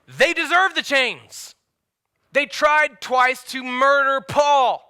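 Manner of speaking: high, slow, loud declaration; forceful, drawn-out pulpit cry; indignant emphasis. Real, not acted. Preacher's indignant climax.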